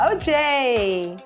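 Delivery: normal talking voice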